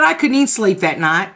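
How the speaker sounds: ordinary delivery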